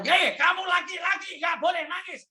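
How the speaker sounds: stern dad shouting at his crying son, pitched high; father yelling at the boy who cries; scolding father. Retold or imitated. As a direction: bark it like an angry father. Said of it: "terus papanya".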